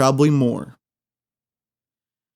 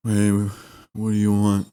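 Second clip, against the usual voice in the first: slow, low bored drone; depressed cashier; 5 semitones lower, slower than usual. Retold or imitated. Cashier greets a customer, slow and joyless. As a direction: drag it out like you hate the job.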